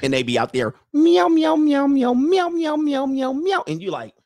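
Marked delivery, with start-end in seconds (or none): 0.9-3.6 loud, high-pitched rapid meows; comic caterwauling imitation; frantic cat meowing. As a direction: yowl like a mating cat, over and over. Imitating cats mating in the alley.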